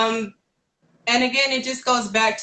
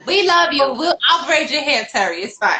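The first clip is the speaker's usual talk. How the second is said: laughing; 6 semitones higher, 4 dB louder than usual. Real, not acted. Teasing friend Terry, laughing.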